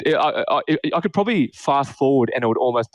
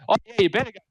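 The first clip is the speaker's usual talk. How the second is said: put-on a raised, huffy old man brushing him off; imitating grandpa; 8 semitones higher, faster than usual. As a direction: hand the excuse back with mock offence. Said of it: "He's like".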